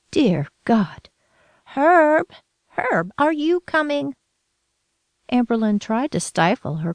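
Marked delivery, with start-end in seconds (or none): 0.1-1.1 whispered in dread; horrified whisper. A narrator's reading. Grandmother aghast at the child, whispered prayer. 1.7-4.1 urgent call. A narrator's reading. Grandmother shouting to her husband, urgent high call.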